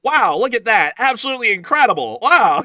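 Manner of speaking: high, loud, gushing mock amazement; Yap Dollar. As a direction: imitate an over-the-top amazed video host. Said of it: "I know Yap Dollar".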